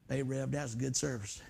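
a beer drinker greeting the pastor; beer-drinking guy. Retold or imitated. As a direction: call out lazily from the truck bed.

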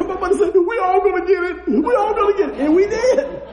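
high, loud, laughing through the words; can barely get it out for laughing; real laughter retelling the punishment. Real, not acted.

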